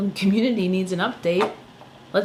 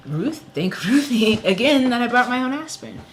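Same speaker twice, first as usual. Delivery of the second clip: 1 laughing; 7 semitones higher, 6 dB louder than usual. Real, not acted. Laughing gratitude mid-rant.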